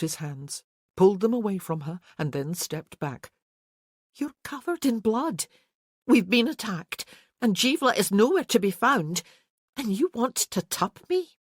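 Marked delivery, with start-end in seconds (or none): 4.1-11.4 higher indignant woman's voice; covered in blood and he wants this; Isabel, incredulous. A narrator's reading. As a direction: disbelief at his timing. Isabel rebukes Kerr.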